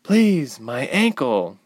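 high plea falling away; strained plea. A narrator's reading. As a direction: muster a last pained appeal. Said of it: "was all Ilse could muster".

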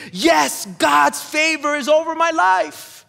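shouted, slow and high, hands-up victory; mock triumphant cry. Preacher hamming up a worshipper's triumphant shout. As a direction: crow it like you just won the parking lot.

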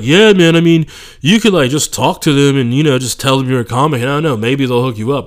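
mock manager giving easy advice; casual bro voice. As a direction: play the manager breezily brushing it off.

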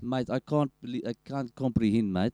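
put-on matey South African accent; baffled South African bloke impression. Riffing for a friend, South African accent. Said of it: "like, you be South African".

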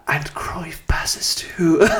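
slow breathy whispered commentary; football ASMR whisper. Pretend. Hosts joke about football ASMR, slow playful whisper. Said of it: "can we do football A S M R?".

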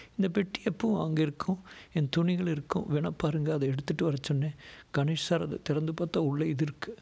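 high-pitched female character voice; anxious woman explaining. A narrator's reading. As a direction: explain yourself to the police, pitched well up.

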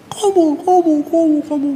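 high-pitched accusing chant; mock finger-pointing. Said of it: "Ini saya". (pura-pura menuding, nada tinggi)

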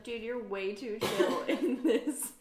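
loud laugh bursting through the words; mock scolding that cracks up midway. Viewer tells film character he's too relaxed, laughing.

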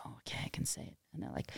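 whispered aside. Retold or imitated.

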